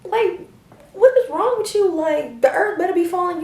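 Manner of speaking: high, loud woken-up outrage; mock outraged, high and loud; groggy outrage. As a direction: yell like someone just woken. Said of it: "I'm like".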